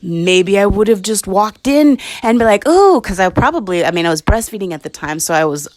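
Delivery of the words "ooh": high, loud ooh at tempting food; a hungry, eager coo; mock delighted ooh. Drawn to food while hungry.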